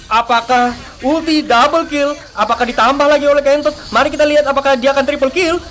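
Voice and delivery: usual speaking voice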